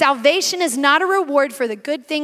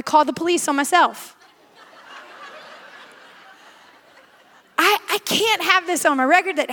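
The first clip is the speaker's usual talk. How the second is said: laughing through the words; 5 semitones higher than usual. Real, not acted. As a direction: giggle at your own confession. Laughing at her own story.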